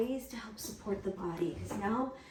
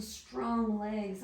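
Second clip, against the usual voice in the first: ordinary talk, nothing marked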